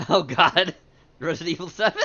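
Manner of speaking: loud, laughing disbelief. Real, not acted. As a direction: crack up mid-sentence. Bursting out laughing at the clip.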